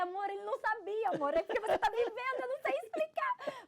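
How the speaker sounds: high, loud, climbing to a squeal; excited explaining. Retold or imitated. Woman to her partner, overwhelmed and loudly excited. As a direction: insist excitedly, pitch soaring by the end.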